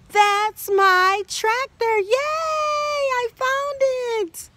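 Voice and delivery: high, loud, slow cheering shout; happy shout. Acted. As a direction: cheer like a child who found his toy. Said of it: "He said".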